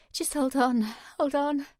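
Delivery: desperate plea. A narrator's reading. Pleading in pain.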